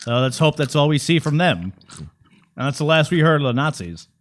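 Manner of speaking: put-on grave wartime broadcaster, Mid-Atlantic accent. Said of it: "You would be good at it".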